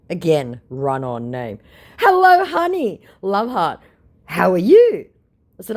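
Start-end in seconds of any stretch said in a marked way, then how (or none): 2.0-5.1 high, loud sugary scammer voice; fake Hugh Jackman voice. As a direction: gush it like a fake celebrity. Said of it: "Now meet Hugh Jackman".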